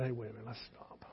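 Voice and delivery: drops to a near whisper; soft, hushed, calming the argument; father hushing a crying teenage son, quietly; hushed plea. Retold or imitated. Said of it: "And I just said".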